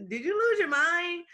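high, loud mock disbelief; incredulous quote. Host imitating people who think she quit something ideal, loud mock disbelief. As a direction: play the shocked onlooker asking if she's lost it. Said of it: "people looking at you like".

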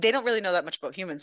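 ordinary delivery

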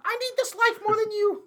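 high, loud granny villain; evil grandma voice. Retold or imitated. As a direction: play the greedy old grandma.

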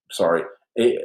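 ordinary talk, nothing marked

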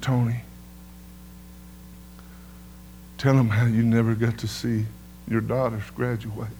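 a hint of God speaking tenderly; gentle divine voice. God telling Tony what to preach, soft tender instruction. God's voice quoted, low, soft.

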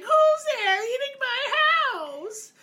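put-on very high, loud old-woman screech; screechy old witch. Blind old woman catching kids eating her house, high loud screech. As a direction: screech it like an angry crone.

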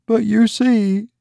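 put-on a worried young wife pouring out her grievance; distressed young wife. A narrator's reading. Laura resumes her complaint.

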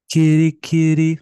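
loud, drawn-out kitty call; playful cat call. Pretend. Playful call after album title.

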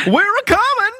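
squeaky bird voice; high eagle voice. Pretend. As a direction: chirp it like a cartoon eagle. Voicing the eagles.

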